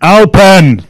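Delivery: loud, high shouted place name; shouted command. Real, not acted. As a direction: bellow it at an unresponsive device. Repeats the voice command much louder.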